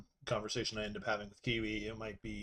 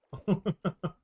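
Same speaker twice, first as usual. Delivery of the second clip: short laugh; 8 semitones higher than usual. Real, not acted. Laugh at own joke.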